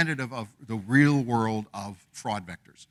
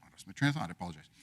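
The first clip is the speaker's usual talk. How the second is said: fading to a near mumble; trailing off; 5 semitones lower, 9 dB quieter, much faster than usual. Real, not acted. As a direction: drop off quietly, losing the thread. Speaker loses thread mid-talk.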